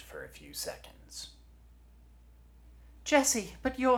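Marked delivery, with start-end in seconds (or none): 3.0-4.0 loud, very high startled outburst; stunned. Acted. Lieutenant hears long-lost Jesse, loud and shocked. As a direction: blurt it out in shock.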